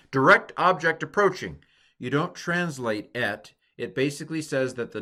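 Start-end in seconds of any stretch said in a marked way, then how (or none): 0.1-1.6 playful warning call, louder and higher; hammed-up robotic alert; mock announcement. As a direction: announce it like an alarm. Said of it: "It says".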